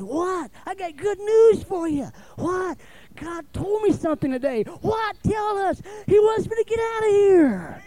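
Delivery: high, shouted back-and-forth with the crowd; excited exchange. Retold or imitated. Preacher and flock trading excited calls. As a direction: play both preacher and eager congregation. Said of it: "You say".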